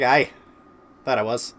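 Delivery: cheeky mock-Irish reply; put-on Irish accent. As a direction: answer back in a lightly faked Irish accent. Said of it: "that stupid accent that you're doing right now".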